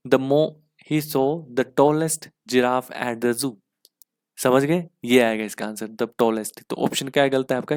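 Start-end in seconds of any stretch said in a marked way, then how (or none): 0.1-3.5 slow dictation. Shown as a demonstration. Teacher reading corrected sentence slowly.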